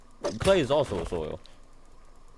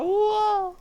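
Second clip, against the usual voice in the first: surprised whoa; 4 dB louder than usual. Real, not acted. Amazed by a new door.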